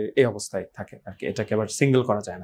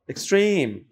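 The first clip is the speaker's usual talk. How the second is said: "Extreme" with high, falling "trim"; second-syllable stress; 6 semitones higher than usual. Shown as a demonstration. Teacher lists another exception, second-syllable stress. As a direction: say "extreme" as ex-TREME. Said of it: "এর কিছু ব্যতিক্রমও আছে".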